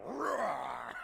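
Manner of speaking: shouted mock battle roar. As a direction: roar like a warrior. Imitating companion's battle roar.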